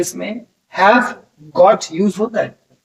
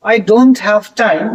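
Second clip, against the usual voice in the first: very slow, each word spaced out; slow example sentence; much slower than usual. Shown as a demonstration. Hindi teacher reads an English example to students, very slowly. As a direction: read it slowly so students can follow.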